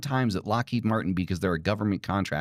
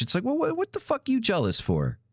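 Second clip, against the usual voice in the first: indignant outburst; 9 semitones higher than usual. Real, not acted. Ranting at eat-the-rich crowd.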